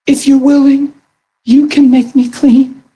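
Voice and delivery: high humble begging voice; pleading leper. Retold or imitated.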